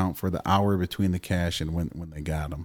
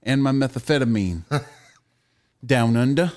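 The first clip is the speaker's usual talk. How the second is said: reading the news in a mock Australian accent, somewhat higher; 6 semitones higher, 3 dB louder than usual. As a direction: ham up an Aussie newsreader. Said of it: "Can you read the story in Australian accent?".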